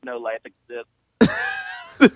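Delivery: mock ominous. Joking about rednecks.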